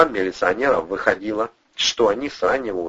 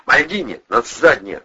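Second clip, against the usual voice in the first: raised, vicious command; angry order through the teeth; spiteful order; 5 semitones higher, 5 dB louder than usual. A narrator's reading. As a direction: force it out with spite. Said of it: "злобно процедил Андрей".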